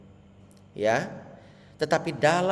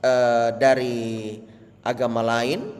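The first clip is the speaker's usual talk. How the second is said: ordinary delivery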